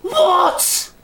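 very high-pitched mock shriek of disbelief; dad's shocked yelp. Son imitating his dad at the haircut reveal, shocked. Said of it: "he literally went like".

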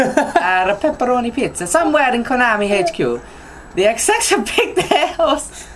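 put-on jokey Italian accent through laughter; mock Italian accent. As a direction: play a silly Italian reading the news. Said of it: "my version of Italian".

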